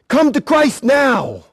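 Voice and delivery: ordinary talk, nothing marked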